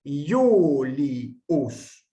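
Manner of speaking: Iulius with I as Y, Yu-li-us; Iulius, first I said like a Y; consonantal I demo. Shown as a demonstration. Teacher showing consonantal I to the class. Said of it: "Por ejemplo".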